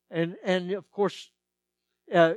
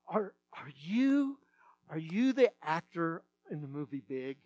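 put-on hesitant little girl; 6 dB quieter than usual. Little girl asks the substitute about a movie, hesitantly. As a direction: imitate a girl nervously working up to asking. Voices awed girls asking him.

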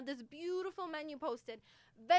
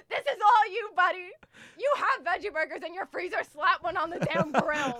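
louder and higher, half-laughing rebuke; tearful scolding; 4 semitones higher, 9 dB louder than usual. Retold or imitated. Retelling how she scolded the waiter, loud, tearful and laughing.

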